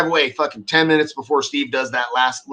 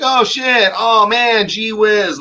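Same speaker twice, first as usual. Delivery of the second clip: higher, louder mocking dopey employees; 8 semitones higher, 5 dB louder, much slower than usual. Mocking the employees who ruined the build, dopey. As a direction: ham up the clueless workers.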